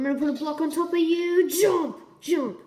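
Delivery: shout. Real, not acted. Shouting at friend in game.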